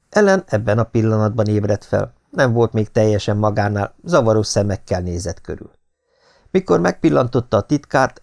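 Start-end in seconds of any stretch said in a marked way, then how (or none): none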